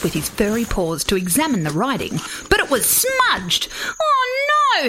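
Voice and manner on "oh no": high, dramatic koala wail; much higher, distressed character voice; dismayed cry. A narrator's reading. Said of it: "he cried".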